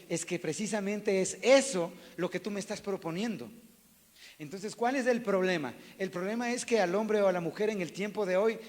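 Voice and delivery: loud, rising sermon voice; preaching at full force. Acted. Pastor preaching loudly to the congregation about infidelity. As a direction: drive the point home to the congregation.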